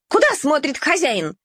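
yap. Acted. Mongrel yapping about the puppy's owner. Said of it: "тявкнула Вилька".

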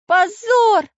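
high, loud squeaky outburst; squeaky outrage; indignant. A narrator's reading. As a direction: exclaim scandalised in a tiny character voice. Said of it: "возмутился Карандаш".

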